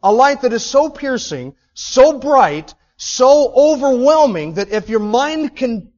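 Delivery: loud, high-pitched sermon build, slowing on key words; preaching at full force. Acted. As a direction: preach it with rising fire.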